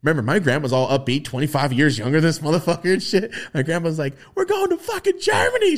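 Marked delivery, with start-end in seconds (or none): 4.4-5.8 high, loud excited squeal; hyped grandma. Retold or imitated. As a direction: shout it like a thrilled grandma.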